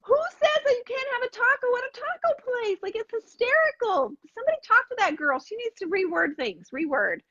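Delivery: high, amazed disbelief; incredulous retelling. Retold or imitated. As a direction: exclaim in disbelief at the girl. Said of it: "I'm like".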